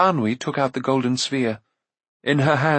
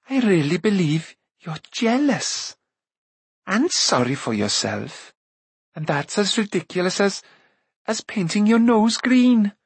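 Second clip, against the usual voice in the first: teasing scold; 8 semitones higher than usual. A narrator's reading. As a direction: tell him off half laughing. Eilonwy scolds Taran.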